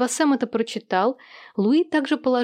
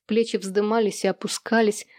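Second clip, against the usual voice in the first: plain reading voice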